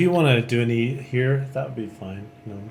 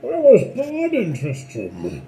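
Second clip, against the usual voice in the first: slow, high voice into a glass; muffled, echoing, drawn-out impression; Bane; 9 semitones higher, slower than usual. Streamer speaking into a glass to turn the impression into a slow, high, muffled villain. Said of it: "Deckard Cain impression into a glass".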